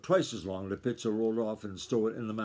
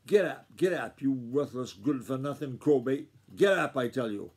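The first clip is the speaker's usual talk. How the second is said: furious shout; 2 semitones higher, 4 dB louder than usual. Acted. Grandfather shouting into the balking colt's face, furious. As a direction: roar it at the stubborn colt. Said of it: "and shouted into the yellow colt's face".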